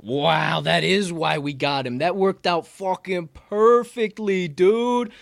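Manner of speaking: loud, high, slow whoop of delight; thrilled outburst. Real, not acted. Host cheering to his partner about the guest, loud and high. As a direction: shout it out, can't believe the luck. Excited reaction to guest news.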